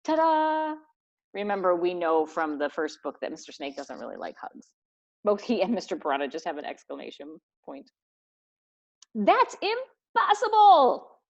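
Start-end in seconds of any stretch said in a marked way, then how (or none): 0.1-0.8 high showy reveal; proud ta-da. Acted. Legs showing off his hack, proud ta-da. 9.1-11.2 very high, loud, slow cry; outraged disbelief. Acted. Snake reacting to the hug status, shrill disbelief.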